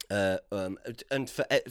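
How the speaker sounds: usual speaking voice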